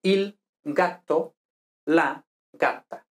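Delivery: slow, careful Italian il gatto, la gatta; Italian demo words. Shown as a demonstration. Teacher to Spanish students, slow Italian model words. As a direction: spell out il gatto and la gatta slowly. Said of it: "il para el masculino, la para el femenino".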